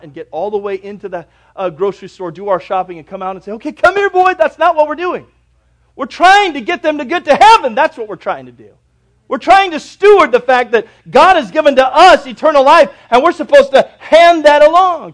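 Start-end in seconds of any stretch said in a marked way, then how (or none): 3.5-4.3 high, loud call to a dog; pitched-up mock dog owner's call. Mimics calling a dog. 4.3-15.1 loud, raised pulpit delivery; full-force preaching. Real, not acted.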